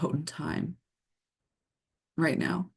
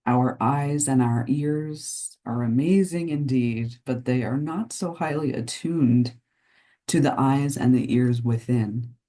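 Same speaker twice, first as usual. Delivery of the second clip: guided meditation. Acted. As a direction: lull listeners into the unseen realm of nature. Delivers a channeled nature message.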